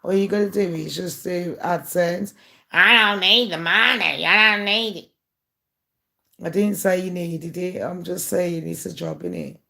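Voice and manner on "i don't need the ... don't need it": louder, insistent mock protest; mocking imitation. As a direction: parrot her, overdoing the denial. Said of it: "she was sitting there like".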